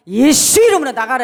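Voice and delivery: loud, high commanding shout; forceful command. Shown as a demonstration. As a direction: show how to order the devil out with force. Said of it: "이래야죠". (힘차게 호통치는 명령)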